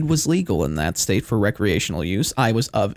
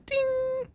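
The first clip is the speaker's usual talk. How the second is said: high ding; 8 dB quieter than usual. Acted. Song ends with a ding.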